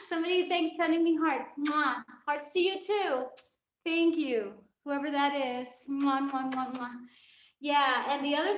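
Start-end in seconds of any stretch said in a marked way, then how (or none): 1.6-2.0 a quick kiss sound into the mic; smacking air kiss; blown kiss. Real, not acted. Thanking viewers for sent hearts. 5.9-6.9 several quick air kisses, fading; shower them with kisses; string of blown kisses. Real, not acted. Thanking viewers for sent hearts.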